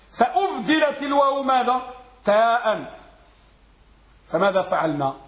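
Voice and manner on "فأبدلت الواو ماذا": ordinary talk, nothing marked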